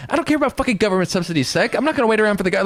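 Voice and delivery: high mock rant, freaking out; mock Anna freaking out at Cenk; Anna impression. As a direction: pitch it up and exasperated. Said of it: "I'm doing a Anna impression".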